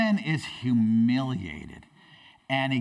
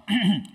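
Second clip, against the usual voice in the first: pointed little ahem; throat clearing; 7 semitones higher, 5 dB louder than usual. Acted. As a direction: clear the throat to interrupt the king. Servant timidly clearing throat to interrupt.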